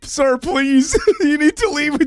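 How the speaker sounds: a cornered pharmacist begging him to go; flustered pharmacist. Retold or imitated. Pharmacist, cornered by a customer's question, begging him to leave, high and panicky.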